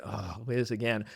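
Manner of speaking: a quiet fed-up aside about a colleague; mock weary grumble; exasperated mutter. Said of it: "I don't say".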